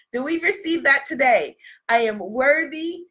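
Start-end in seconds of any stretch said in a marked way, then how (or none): none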